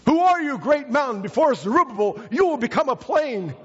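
high, ringing proclamation of scripture; a prophet defying the mountain; proclaiming. Acted.

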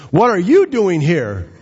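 slow question, YOU lifted high; stress on YOU. Shown as a demonstration. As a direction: lean hard and long on the word "you". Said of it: "The focus is on you".

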